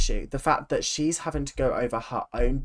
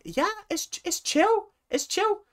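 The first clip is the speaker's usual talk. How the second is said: mock nonchalance; 5 dB quieter than usual. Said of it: "they're all acting like".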